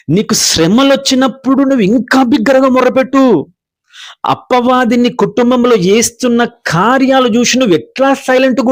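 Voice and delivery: preacher crying out to the church for their families; fiery preaching. Acted. Preaching climax at full force.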